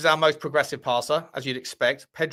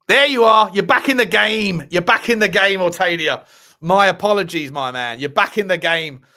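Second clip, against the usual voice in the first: excited greeting; 8 dB louder than usual. Real, not acted. Welcomes back unblocked viewer loudly.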